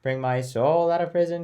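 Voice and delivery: half spoken, plain pitch line; just speak the phrase; talky, spoken. Shown as a demonstration. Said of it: "do the first part really talky".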